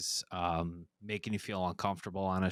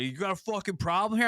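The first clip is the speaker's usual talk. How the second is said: higher, mock-aggressive challenge from a tough guy; imitating a thug; 10 semitones higher, 3 dB louder than usual. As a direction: play the menacing buddy squaring up. His friends turning on him.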